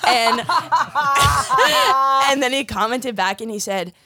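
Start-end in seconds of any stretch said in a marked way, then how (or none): 0.5-2.3 big shouted burst of laughter; loud laugh. Real, not acted. Laughing hard at her own comment reply.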